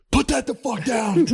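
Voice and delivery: high, slow mock scream; parent spazzing. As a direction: imitate a parent blowing up at a child. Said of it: "when a parent spazzes on the kids".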